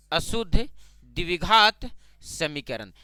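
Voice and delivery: अशुद्ध, द्विघात, समीकरण spaced out and stretched; slow dictation. Shown as a demonstration. Term repeated slowly for the class. (अशुद्ध द्विघात समीकरण धीरे-धीरे, रुक-रुक कर)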